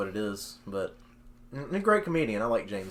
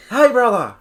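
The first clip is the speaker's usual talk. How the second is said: hearty greeting; 10 semitones higher, 10 dB louder than usual. Retold or imitated. Comedian's greeting shouted back.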